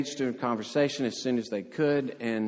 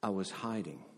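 softly understated, no emphasis; quiet, unemotional admission; matter-of-fact; 9 dB quieter than usual. Retold or imitated. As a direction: say it simply and quietly, like small talk. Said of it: "same kind of emotion ... telling you".

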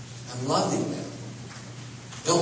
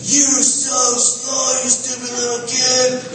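much higher, slow, singsong taunting parent; mocking harsh father; 11 semitones higher, 3 dB louder than usual. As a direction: jeer at the child like a cruel dad, drawn out. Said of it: "Imagine a father running ahead of him and saying".

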